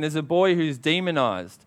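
usual speaking voice

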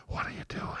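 soft whisper, gently asking; compassionate whisper. Retold or imitated. God's voice to the man in the closet, whispered with compassion. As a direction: whisper it like a caring voice in the dark. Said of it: "it was so compassionate".

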